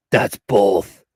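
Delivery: low menacing villain growl; deep theatrical bad guy; supervillain voice. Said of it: "Let me get my supervillain voice".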